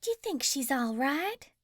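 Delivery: a light hint of a sweet, anxious lady; worried sweet voice. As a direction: fret over her, gently. A worried witch over June.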